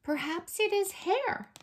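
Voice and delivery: storybook character, pitched high; high-voiced animal character. A narrator's reading. Guessing in a character's voice.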